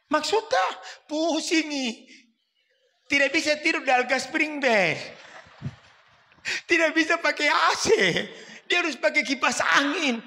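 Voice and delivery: put-on high, fretful old mother's voice; complaining mother. Old mother complaining to her son, high and fretful. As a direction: whine it like an ailing mother.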